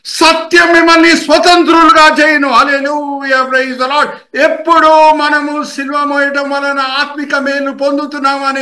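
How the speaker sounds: high, sustained preacher's cry, easing gradually; fervent preaching in long high-pitched phrases; impassioned preaching. Acted. Preaches at full force.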